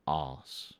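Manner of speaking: mock British "Os" for arse; British accent. Shown as a demonstration. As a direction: clip it short the British way, "Os". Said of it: "I said it Britishly".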